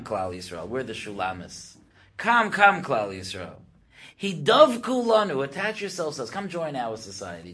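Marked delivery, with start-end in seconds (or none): 2.2-3.6 emphatic urging; calling out. Acted. Nations calling Israel over. 4.2-7.5 high, emphatic appeal; insistent invitation; urging. Acted. Nations urging Israel to join.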